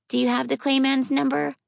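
put-on high little girl voice; child voice. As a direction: speak like a serious young girl. Child asking seriously.

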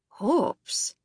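gruff Scot, grudgingly impressed. A narrator's reading. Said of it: "gruff Scottish voice sounded grudgingly impressed".

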